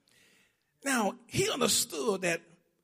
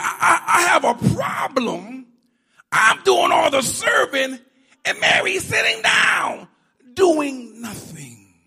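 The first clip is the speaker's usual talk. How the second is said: loud, high upset outburst, slowing on the last words; upset Martha; 6 semitones higher, 7 dB louder than usual. Acted. Martha complains Mary sits while she serves, loud and upset. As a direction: build up the grievance then drag it out. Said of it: "She was upset".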